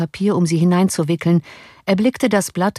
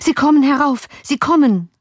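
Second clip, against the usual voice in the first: high, louder cry of alarm; erschrockenes Rufen; 10 semitones higher, 4 dB louder than usual. A narrator's reading. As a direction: servant shouting a panicky warning. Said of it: "rief der Diener". (angstvoll rufend)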